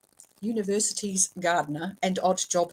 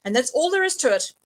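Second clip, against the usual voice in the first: louder, higher, final word; firm; 4 semitones higher, 6 dB louder than usual. A narrator's reading. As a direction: close the argument firmly. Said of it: "said Ridcully firmly".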